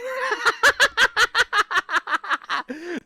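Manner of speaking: very high, loud cackling laugh; high laughter. Real, not acted. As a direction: shriek with laughter. Laughs after his shout.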